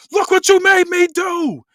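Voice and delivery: high, very loud, slowed scream; furious acted roar of blame; pissed-off yell. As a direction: scream the blame at him, dragging it out. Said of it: "yells real loud, pissed off".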